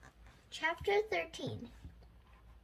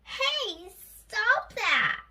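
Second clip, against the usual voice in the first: high, loud villain's protest in a put-on voice; crying out; 8 semitones higher, 6 dB louder, much slower than usual. A narrator's reading. President Penguin hit by jelly beans, crying out high and loud. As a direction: squawk it as the pelted villain. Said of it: "cried President Penguin".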